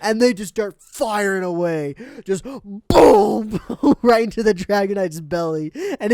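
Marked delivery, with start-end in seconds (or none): 2.3-3.6 a shouted boom of cannon fire; mock explosion. Recapping the cartoon, imitating the bazooka blast.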